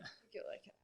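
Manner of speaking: soft unvoiced whisper, just breath; whispered under the banter; whispered aside. Real, not acted. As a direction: lean in and breathe it.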